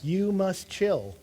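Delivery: calm down. Retold or imitated. Telling angry friend to calm down.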